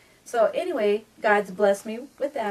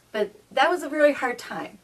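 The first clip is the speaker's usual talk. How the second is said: ordinary talk, nothing marked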